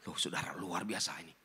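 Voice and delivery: hushed, breathy wonder, half to himself; amazed whisper. Real, not acted. Preacher marvels to his congregation in a hushed whisper.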